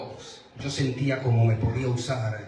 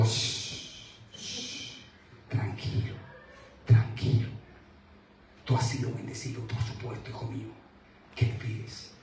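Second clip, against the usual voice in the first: hushed, breathy whisper of comfort; God's soothing whisper; 5 dB quieter than usual. Retold or imitated. Preacher voicing God, whispering comfort to himself. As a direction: softly shush him like a calming father. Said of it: "el Señor vino ... y me dijo".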